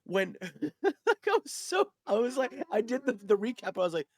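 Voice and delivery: giddy storytelling rush; excited. Real, not acted. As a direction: gush about how it went. Excited retelling of joining game.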